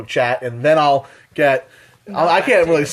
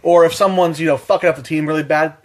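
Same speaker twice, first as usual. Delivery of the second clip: normal talking voice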